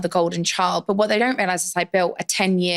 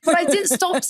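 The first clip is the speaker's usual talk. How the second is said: much higher, laughing with disbelief; excited laughing squeal; 12 semitones higher than usual. Retold or imitated. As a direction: squeal it out in joyful shock. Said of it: "I was like".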